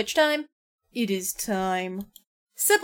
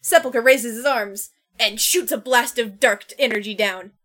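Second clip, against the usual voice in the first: usual speaking voice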